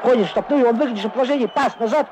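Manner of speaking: rising thrill of a near goal; excited commentary. Real, not acted. Goal chance in live commentary. (взволнованный репортаж)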